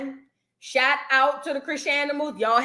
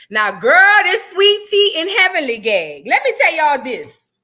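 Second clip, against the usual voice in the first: loud announcement; 5 semitones higher, 9 dB louder than usual. Real, not acted. Launching into story loudly.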